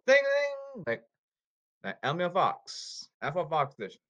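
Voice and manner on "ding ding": mock bell chime. Mock bell before the quiz.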